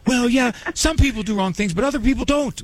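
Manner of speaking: high-pitched mock naive objection; naive kid voice. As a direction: mimic an innocent kid protesting. Acts out naive kids' reply.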